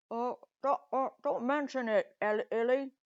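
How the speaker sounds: muffled words round a mouthful of egg; talking with egg in mouth. Acted. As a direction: mumble it like your mouth is stuffed with an egg. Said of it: "she got an egg in her mouth".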